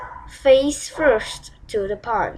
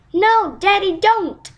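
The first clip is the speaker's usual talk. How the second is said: high, louder plea to stop him; pleading cry; 8 semitones higher, 4 dB louder than usual. Acted. Poppy begging the king not to send the footman, high pleading cry. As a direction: beg her father not to. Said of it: "cried Poppy".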